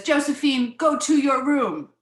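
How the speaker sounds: loud, high parental order; storybook mom sending a child away; cross mother. Acted. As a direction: raise your voice as a fed-up mother. Mother sends child to room.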